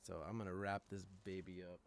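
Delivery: ordinary talk, nothing marked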